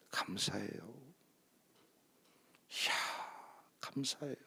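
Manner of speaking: hushed, moved murmur of thanks; quiet thanks. Retold or imitated. Moved pastor quietly thanking the preacher, hushed. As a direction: softly breathe out a thank-you, eyes closed.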